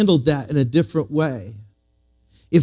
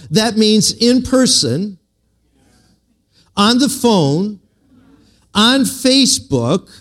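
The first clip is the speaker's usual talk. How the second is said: slowed, each place stressed and higher; slow, building emphasis; 8 semitones higher, slower than usual. Shown as a demonstration. Preacher to the congregation, slow and emphatic. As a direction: lean on every place, slowly building.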